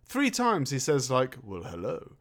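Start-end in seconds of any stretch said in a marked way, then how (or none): 1.4-2.1 low, soft British greeting; British accent. Acted. Actor slipping into a British accent for no reason. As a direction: put on a British hello, dropped low and soft. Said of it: "in a British way for no reason".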